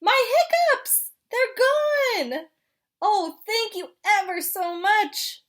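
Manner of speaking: high, loud joyful penguin; thrilled hiccups are gone; excited penguin voice. Acted. Penguin delighted.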